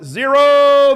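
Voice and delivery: called out loud and high, held; loud final count. Real, not acted. Coach calling time on the walkers, loud and high. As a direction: ring out the end of the set.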